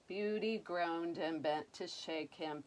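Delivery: ordinary delivery, nothing marked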